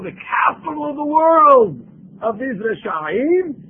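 fiery pulpit outcry; preaching shout. Real, not acted. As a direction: thunder it at the congregation. Denouncing San Francisco from pulpit.

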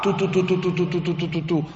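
rapid staccato toots; teruah shofar imitation. Shown as a demonstration. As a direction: sound out a run of quick, short horn blasts. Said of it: "we call that teruah".

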